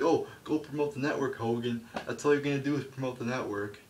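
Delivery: high, loud mocking order; company ordering Hogan around, hammed up; mock bossy company. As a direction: bark it like a smug boss. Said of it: "they're like".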